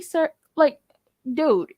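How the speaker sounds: ordinary delivery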